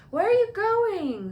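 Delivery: sleepily. A narrator's reading. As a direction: call after him. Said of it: "said Ron sleepily".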